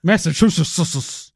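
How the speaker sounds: "Massachusetts" slowed into a string of "sus-sus-sus"; slow, mushy "Massachusususus"; mouthful of jelly beans. Shown as a demonstration. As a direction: overdo the slur on "Massachusetts", extra syllables. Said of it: "It's like I had a mouthful of jelly beans".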